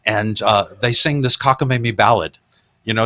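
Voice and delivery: normal talking voice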